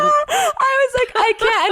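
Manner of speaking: laughing. Real, not acted. Still laughing at the memory.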